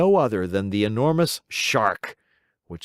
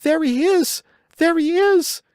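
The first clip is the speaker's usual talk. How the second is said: much higher, louder frantic goat; alarmed goat cry; 6 dB louder than usual. Acted. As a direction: scream a warning as the shark nears.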